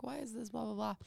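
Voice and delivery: ordinary talk, nothing marked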